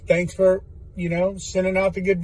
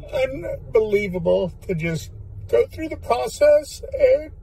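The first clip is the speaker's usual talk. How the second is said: fighting back tears, higher; choked up; 4 semitones higher than usual. Real, not acted. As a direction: voice cracking with real emotion. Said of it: "I'm choking up here".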